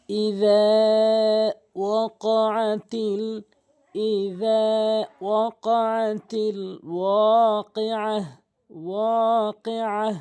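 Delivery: slow chanted tilawat, every vowel stretched; Quran recitation. Shown as a demonstration. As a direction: recite slowly as a model for students. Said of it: "এবার আসুন একটু বানান শিখি।".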